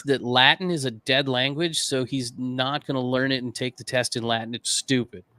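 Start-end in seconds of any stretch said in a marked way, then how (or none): none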